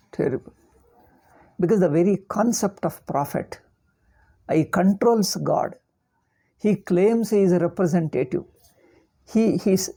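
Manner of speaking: ordinary talk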